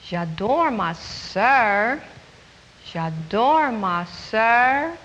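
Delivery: slow "J'adore ma sœur" rising on "J'adore"; model French line. Shown as a demonstration. Teacher modelling the line for children, slow and clear. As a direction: lift "J'adore", then say "ma sœur" slowly. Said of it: "The next line means".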